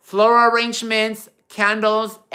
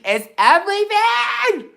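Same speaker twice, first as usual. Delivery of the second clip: much higher, put-on gushing voice; high playful gush agreeing with the viewer; high gushing echo; 12 semitones higher than usual. Real, not acted. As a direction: echo the compliment with a high squeal. Echoing a viewer's comment.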